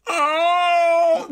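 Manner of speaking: loud high alarm-like "Oh!"; Fran Drescher yelp. Retold or imitated. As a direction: blare it like a foghorn. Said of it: "starts every sentence with".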